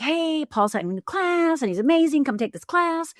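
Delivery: high, bubbly sales pitch to a friend; eager pitch. Retold or imitated. Urging her friend to take Paul's class, eager. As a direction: sell it with bright enthusiasm.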